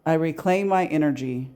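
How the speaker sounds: slow, measured meditation voice with long pauses; unhurried, evenly paced guided affirmation. Acted. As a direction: lead a calm release script, phrase by phrase, leaving room to repeat. Leads a guided release meditation.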